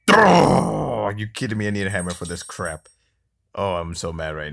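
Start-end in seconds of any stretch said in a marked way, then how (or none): 0.1-1.0 loud, high drawn-out "oh" falling in pitch; frustrated cry. Real, not acted. Player thwarted by needing a hammer, exasperated wail.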